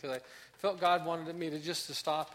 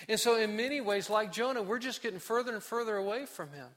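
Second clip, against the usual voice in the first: ordinary talk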